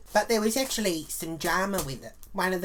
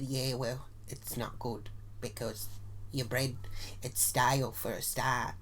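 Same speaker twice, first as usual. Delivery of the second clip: snide low mutter; 7 semitones lower, 8 dB quieter than usual. Retold or imitated. Snide comeback at a party.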